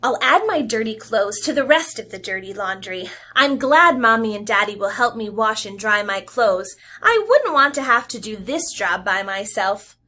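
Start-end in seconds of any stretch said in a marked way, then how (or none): none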